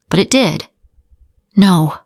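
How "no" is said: usual speaking voice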